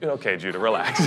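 imagined listener, low and dry, telling the preacher to relax; mock listener. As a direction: flatly tell Judah to calm down.

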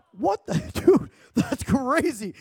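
high, drawn-out amazed reaction; stunned disbelief. Retold or imitated. Retold amazement at gold dust.

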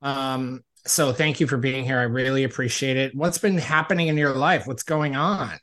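loud, upbeat show-host delivery; podcast host intro. Acted. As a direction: pump up the welcome like a host. Podcast host opening the episode.

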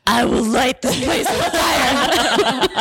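slow, loud, high mock fury; over-the-top seething, played for laughs; hammed-up jealous threat.

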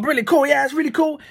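high, mock-thrilled "really cool"; mock excited gush. Eager buyer mock-gushing over a Facebook ads course, high and thrilled. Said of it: "You're like".

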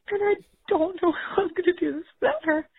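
tearful, half-laughing through tears; trying to hold back real tears; crying. Real, not acted. Mom on a voicemail admitting she can't cope, crying. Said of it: "I am having a breakdown".

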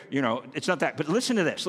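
ordinary talk, nothing marked

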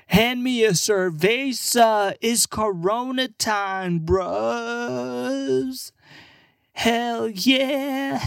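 high, slow party-bro drawl; drunk American bro. Acted. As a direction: stretch it out like a hammed-up drunk reveler. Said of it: "get drunk".

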